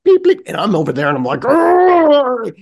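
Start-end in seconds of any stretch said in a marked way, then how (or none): 0.0-0.4 very high little beeps; high-pitched mock machine beeps; imitated checkout beeps. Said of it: "And she's bleep, bleep". 1.4-2.5 long, loud, high "argh"; acted groan of frustration; frustrated growl. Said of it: "like, I'm frustrated".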